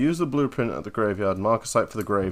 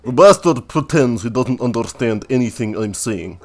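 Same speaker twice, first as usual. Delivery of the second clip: louder, hammed-up Russian accent; 4 dB louder than usual. Donkey grumbling to the new graveyard keeper, Russian accent. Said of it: "I have to give him a Russian accent now".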